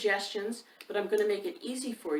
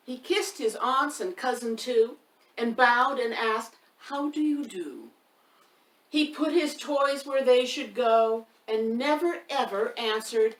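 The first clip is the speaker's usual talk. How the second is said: louder, higher storybook recital with a bouncing beat; lively rhyme reading; 5 semitones higher, 8 dB louder, slower than usual. A narrator's reading. Reading a rhyming book aloud to young children, loud and sing-song. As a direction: read the rhyme out big for the little ones, leaning on the rhythm.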